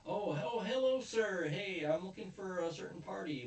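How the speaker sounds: high, put-on overfriendly greeting; mock innocent bluff. Retold bluffing past a guard.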